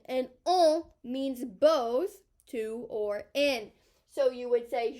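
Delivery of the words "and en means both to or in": "en" and "both" slow and louder, with a rise on "en"; stress on en. Shown as a demonstration. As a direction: draw out "en means both" for the class. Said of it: "teacher stresses en meaning".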